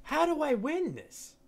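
very high-pitched plea; high, exasperated cry; frustrated wail. Real, not acted. Keeps losing, frustrated.

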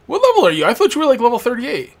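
high, loud, friendly banter with a customer; cheery shopkeeper. Acted. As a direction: greet the regular with big enthusiasm.

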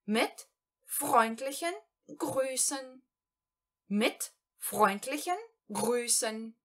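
mit freundlichen Grüßen, slowly, stressing freundlichen; slow German phrase. Shown as a demonstration. Teacher models a letter sign-off for learners, slowly. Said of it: "no final das nossas cartas".

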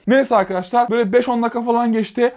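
ordinary talk

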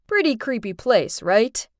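ordinary delivery, nothing marked

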